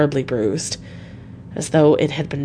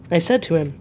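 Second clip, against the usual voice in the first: ordinary delivery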